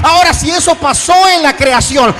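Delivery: full-force preaching. Real, not acted. Pastor tells the church how God's word made creation, preaching forcefully. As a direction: drive each phrase out to the congregation.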